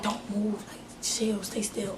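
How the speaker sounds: urgent near-whisper; hushed warning. Retold or imitated. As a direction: keep it down so nobody hears. Hushed warning during break-in.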